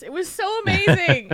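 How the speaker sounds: gushing with relief; laughing with joy; laughing delight. Real, not acted.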